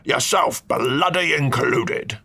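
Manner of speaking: slow, rising cranky tirade pitched high; an indignant old lord building to fury; ranting old duke. Acted. Duke rants at the uninvited guest about his ruined Christmas.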